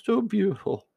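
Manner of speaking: high, drawn-out cooing over a kitten; doting dad talk. Real, not acted. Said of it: "I talk like that as I'm a dad".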